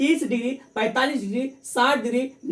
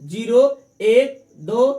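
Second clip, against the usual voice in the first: louder, very slow counting, each number hammered; slow drilled count; 6 dB louder, slower than usual. Shown as a demonstration. Teacher drilling the class on the trick, loud and slow. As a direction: chant zero, एक, दो one by one like a drill. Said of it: "क्या है?".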